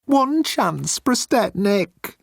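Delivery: high, slow pleading; high desperate pleading alien; begging. Acted. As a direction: beg for mercy. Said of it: "Moan begged".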